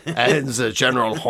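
hammed-up movie French accent; mock French accent. Describing the footballer's interview, mock French accent. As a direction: play a shrugging film Frenchman. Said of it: "French guy from a movie".

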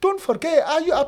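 high, slow and emphatic, carried away; excited raving. Real, not acted. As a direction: pitch it way up and stretch miles out. Raving about the gaffer.

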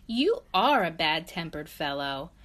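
ordinary delivery, nothing marked

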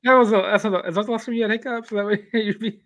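laughing through the words; can barely get it out for laughing. Real, not acted. Cracking up at the hiccup story.

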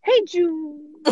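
high, drawn-out sing-out greeting; phone greeting. Retold or imitated. Monica answering the phone to a friend, high cheery call. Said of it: "and she says".

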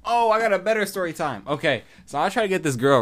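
high, loud burst of excitement; sudden excitement. Real, not acted. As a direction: burst in with a better story. Suddenly remembers a better story.

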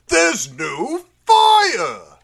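loud, slow, drawn-out reveal; dramatic exclamation. Acted. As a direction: burst out of the hush with a big reveal. Dramatic reveal after whispering.

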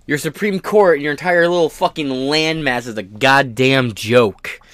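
ordinary delivery

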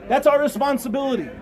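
ordinary talk

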